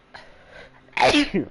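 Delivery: loud sudden sneeze; sneezing hard. Real, not acted. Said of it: "I hate when I have to sneeze like that".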